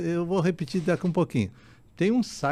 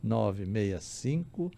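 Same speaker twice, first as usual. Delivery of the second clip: very slowly; much slower than usual. Shown as a demonstration. Repeating phone number for listeners.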